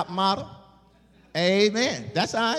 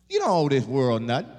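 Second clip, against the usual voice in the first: normal talking voice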